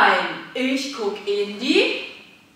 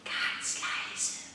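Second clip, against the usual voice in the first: unvoiced hush; whisper; 13 dB quieter than usual. Shown as a demonstration. Coach whispering as the kids creep up. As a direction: sneak in softly. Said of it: "schleicht euch leise an".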